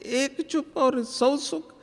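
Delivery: high, slow puzzled repeat of the saying; puzzled echo. Retold or imitated. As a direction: echo it back high and wondering. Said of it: "मैंने कहा". (ऊँची, धीमी, हैरानी भरी दोहराई)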